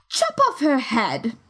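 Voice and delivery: slow, casual cruel command; menacing duchess. A narrator's reading. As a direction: order it offhandedly. Duchess orders execution.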